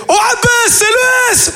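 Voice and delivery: high shout to the father; cheeky little brother. Retold or imitated. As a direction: yell it up high like a cocky kid. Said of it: "il fait". (petit frère insolent, crié très haut)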